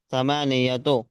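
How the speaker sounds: normal talking voice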